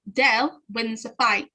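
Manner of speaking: ordinary talk, nothing marked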